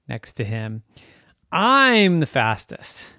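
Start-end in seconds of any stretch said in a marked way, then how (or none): 1.5-3.1 slow, loud, higher brag; boastful. Acted. Yugo insists to the other cars, boasting. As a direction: stretch out a proud claim.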